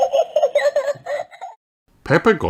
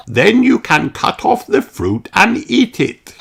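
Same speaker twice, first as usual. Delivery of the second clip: put-on higher, kindly old grandpa teaching; Grandpa Pig explaining; 11 semitones higher than usual. A narrator's reading. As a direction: grandfather showing Peppa how seeds grow, gently.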